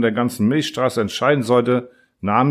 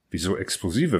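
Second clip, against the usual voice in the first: softer, puzzled question; confused; 3 semitones lower, 6 dB quieter than usual. A narrator's reading. Earthling puzzled by the Tarakaner's question, confused. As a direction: ask it quietly, at a loss. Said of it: "fragte ich verwirrt".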